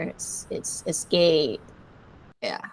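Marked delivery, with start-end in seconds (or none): 0.9-1.6 escape with a drawn-out "ay"; long A. Shown as a demonstration. Teacher correcting a short vowel, escape with long "ay". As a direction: say escape slowly, stretching the A. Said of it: "the A is long there".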